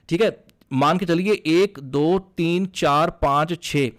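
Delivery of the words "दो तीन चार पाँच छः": usual speaking voice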